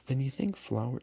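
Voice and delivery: put-on high, eager child voice; a small boy pressing his question; little prince voice. Prince cut off.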